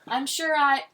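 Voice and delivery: high, loud, grand star voice; theatrical diva. A narrator's reading. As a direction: project like a stage actress. Soap star addressing the crowd.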